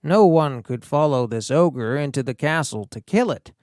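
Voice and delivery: usual speaking voice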